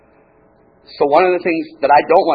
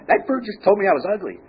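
high-pitched offended customer; indignant lady making a complaint; 8 semitones higher than usual. Acted. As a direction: complain in an affronted, raised pitch. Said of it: "she gets all offended".

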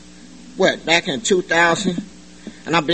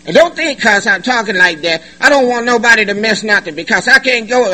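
ordinary talk, nothing marked

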